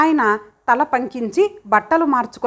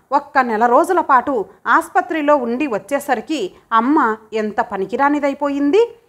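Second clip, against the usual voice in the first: ordinary delivery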